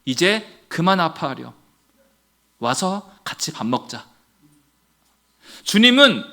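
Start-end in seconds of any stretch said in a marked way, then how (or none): 0.0-4.1 soft, low, gentle invitation; tender Lord's voice. Acted. The Lord invites hurting Peter to come and eat, tenderly. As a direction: speak low and soothingly, with pauses. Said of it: "그리고 이렇게 말씀하십니다".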